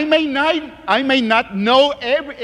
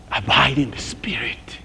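whisper; 9 semitones lower, 4 dB quieter than usual. Retold or imitated. Quoted inner prayer, whispered.

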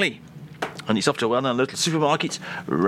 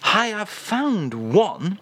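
slow translation; 4 semitones higher, slower than usual. Shown as a demonstration. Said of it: "translates into your actual English".

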